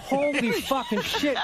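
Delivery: slow, laughing mock panic; half-laughing at his own panic; laughing disbelief. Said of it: "I was like".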